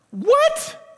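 very high incredulous yelp; mock disbelief. Listener told to forgive seven times, hammed-up incredulous yelp. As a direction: squeak it out in hammed-up shock.

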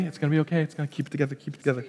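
soft, dropping voice to calm himself; calming self-talk. Acted. Pastor soothing an anxious congregation, hushed. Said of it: "don't freak out".

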